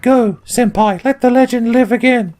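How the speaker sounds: high, theatrical princess plea; hammed-up heroine urging on a hero; Zelda. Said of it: "Zelda's kinda like Ocelot. She's like".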